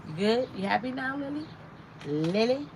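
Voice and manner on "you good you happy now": ordinary delivery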